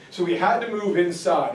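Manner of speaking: ordinary talk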